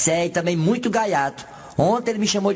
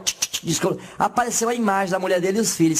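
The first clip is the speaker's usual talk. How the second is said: ordinary talk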